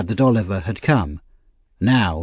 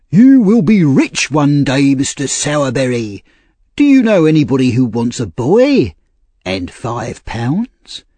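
louder, drawn-out official's voice; pompous workhouse official; 4 semitones higher, 5 dB louder than usual. A narrator's reading. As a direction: play the self-important Mister Bumble, pitched up.

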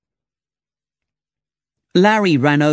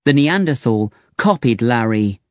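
ordinary delivery, nothing marked

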